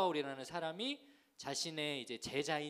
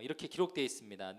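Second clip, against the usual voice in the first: normal talking voice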